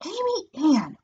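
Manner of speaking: reproving mother. A narrator's reading. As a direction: call your daughter to order. Mother scolding screaming daughter.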